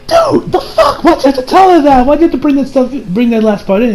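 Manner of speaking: high-pitched exasperated disbelief; acted-out outburst of disbelief, starting very high; incredulous protest. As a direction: demand an explanation in a high, exasperated voice. Acts John's outraged reaction.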